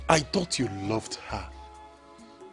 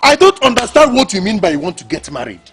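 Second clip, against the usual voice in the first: higher, louder, fast indignant scolding; angry outburst; 5 semitones higher, 7 dB louder than usual. Acted. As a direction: lash out in disbelief. Sibling confronts widower angrily.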